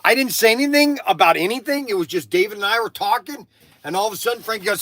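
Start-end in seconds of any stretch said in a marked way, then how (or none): none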